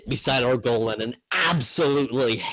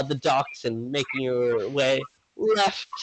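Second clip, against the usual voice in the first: usual speaking voice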